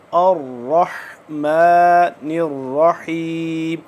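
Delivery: रहमानिर with a full heavy र, slow; heavy ra recitation. Shown as a demonstration. Teacher recites रहमानिर रहीम for students, slow and heavy. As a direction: say रहमानिर slowly with a thick र. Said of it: "रा मोटा है और तशदीद है".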